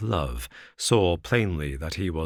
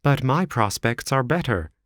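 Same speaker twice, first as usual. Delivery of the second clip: warmly; 6 semitones higher than usual. Acted. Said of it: "said the other warmly".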